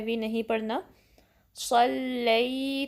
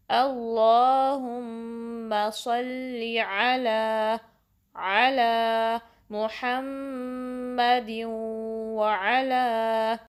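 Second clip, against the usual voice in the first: slow, drawn-out chanted recitation; measured tajweed recitation; slow Quranic recitation; much slower than usual. Shown as a demonstration. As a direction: recite it carefully, stretching the long vowels.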